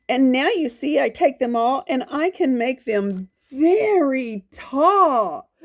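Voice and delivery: sing-song storybook read of the leopard's boast; reading to children. Acted. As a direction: read it like a proud little rhyme to kids. Reads the leopard's rhyme.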